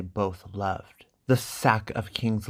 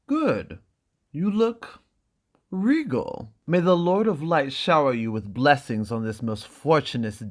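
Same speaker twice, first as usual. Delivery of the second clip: fawning merchant. Acted. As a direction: fuss over her like a pleased merchant. Illyrio dressing Dany.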